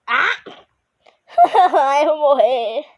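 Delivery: dying of laughter; laughing. Real, not acted. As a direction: gasp out the words laughing. Dies laughing.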